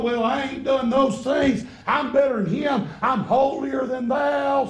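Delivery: high, loud, slow smug boasting; smug self-righteous. Retold or imitated. Preacher voicing the proud elder son, loud and smug. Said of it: "snapping them spiritual suspenders".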